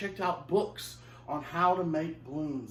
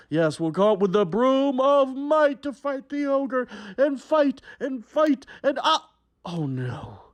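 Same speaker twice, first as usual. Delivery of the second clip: big, slow fairy-tale narration; hammed-up storyteller for a young audience; storytelling to children; 9 semitones higher, 9 dB louder than usual. A narrator's reading. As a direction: tell it like a melodramatic storybook. Reads fairy tale to kids.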